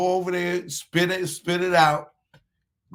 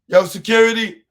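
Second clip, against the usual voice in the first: mock call for security, laughing; 4 semitones higher, 9 dB louder than usual. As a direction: shout for security through a laugh. Mock calling security on viewer.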